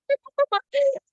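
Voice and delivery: a sudden high shriek of a laugh; burst of high laughter. Real, not acted. Host cracking up while telling the texting story, high burst of laughter. Recalling the funny text exchange.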